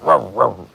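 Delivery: imitated dog woofs; short mock barks, copying a grumbling dog; Archer. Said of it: "my Archer impression".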